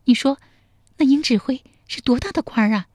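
put-on high, quick playful young woman; girl's teasing voice. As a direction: tease him in a bright girlish voice. 陶三春 voiced by narrator. (少女俏皮地调侃)

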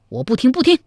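high-pitched, agitated refusal; heated refusal. Acted. Zhao Kuangyin refusing to hear his adviser out, agitated. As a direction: refuse to listen, voice shooting up. Acted quarrel, refuses to listen.

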